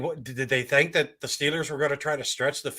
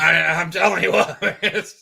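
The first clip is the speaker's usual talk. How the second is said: laughing; 4 semitones higher, 8 dB louder than usual. Real, not acted. Laughing after choking.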